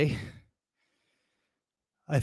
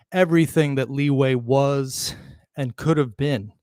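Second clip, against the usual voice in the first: usual speaking voice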